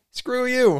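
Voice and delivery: high-pitched comic brush-off; mock dismissive. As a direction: toss it out as a hammed-up dismissal. Voices Santa dismissing the toys.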